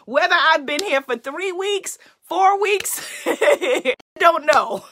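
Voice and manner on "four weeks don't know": loud, breaking into real laughter; amused and loud, laughing between words. Real, not acted. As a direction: laugh at having no idea. Laughs at losing track of time.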